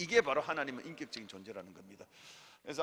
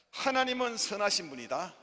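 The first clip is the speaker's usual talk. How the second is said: preaching; 5 semitones higher, 8 dB louder than usual. Real, not acted.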